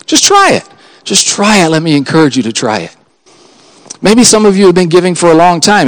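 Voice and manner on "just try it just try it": urging. Real, not acted.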